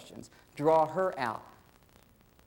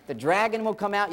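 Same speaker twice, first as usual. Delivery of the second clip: usual speaking voice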